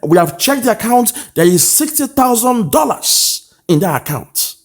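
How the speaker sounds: very high, pushy voice giving the order to pay; high-pitched impression of a committee member demanding payment; insistent mock quote. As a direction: play the official who insists the money is there. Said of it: "And who else is saying".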